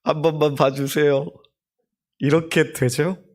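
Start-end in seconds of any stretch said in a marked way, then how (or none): 0.0-1.3 mild imitation of a child begging for mercy; a child asking to be let off, a touch of pleading; boy begging his mother to stop, lightly voiced. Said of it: "톤도 낮아지고 말도 느려지면서".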